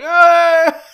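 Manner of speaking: high, loud, hammed-up "ah"; mock Rastafarian cry. Mimicking a film's Rastafarian disguise, exaggerated high cry. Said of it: "stereotypical, like, uh, Rastafarian".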